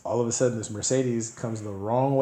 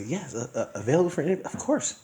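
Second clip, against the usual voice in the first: Caucasian voice; 6 semitones higher than usual. Retold or imitated. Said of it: "I put on my best Caucasian voice".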